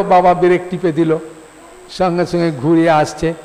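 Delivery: ordinary talk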